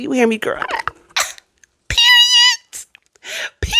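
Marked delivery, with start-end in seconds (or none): 1.9-3.7 high squealed "Period.". Real, not acted. Emphatic sign-off exclamation.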